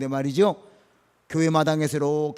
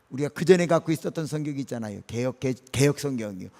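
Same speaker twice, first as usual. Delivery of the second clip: normal talking voice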